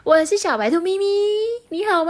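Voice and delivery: high, bright rabbit voice; cheerful bunny. Acted. Mimi the rabbit greeting Hulu, high and cheerful. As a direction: greet everyone sweetly.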